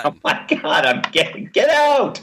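high mock panic, laughing. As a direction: play a spooked churchgoer. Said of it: "Holy shit".

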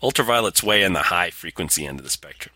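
ordinary delivery

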